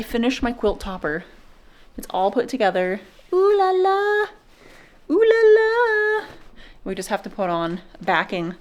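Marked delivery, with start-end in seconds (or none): 3.3-4.3 high playful French-ish ooh la la; mock fancy ooh la la. Showing off her quilt topper to the camera, high playful ooh la la. 5.1-6.3 high, slow, stretched ooh la la; drawn-out mock French. Repeating ooh la la to the camera, slow high and drawn out. Showing off finished quilt topper.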